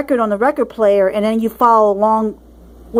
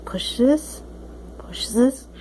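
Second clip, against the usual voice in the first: whisper; 7 dB quieter, much slower than usual. Real, not acted. Pressing a button, whispering.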